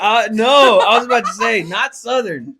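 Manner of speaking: high, very loud objection; loud protest. Real, not acted. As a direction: burst in to set them straight. Loud protest while cracking up.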